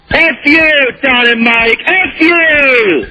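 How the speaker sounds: yelled insult. Real, not acted. Caller yelling at the hosts.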